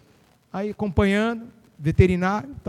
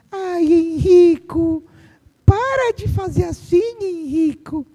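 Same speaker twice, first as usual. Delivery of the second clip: much higher, slow, dainty scolding; timid mother; 6 semitones higher, 3 dB louder, much slower than usual. Retold or imitated. Teacher mimicking a mother who scolds her son daintily, high and slow. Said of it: "Ela fala assim".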